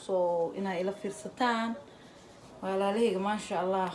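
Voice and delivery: usual speaking voice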